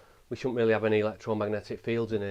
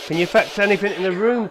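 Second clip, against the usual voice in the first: calling out; 8 semitones higher, 8 dB louder than usual. Real, not acted. Calling out to the spirits.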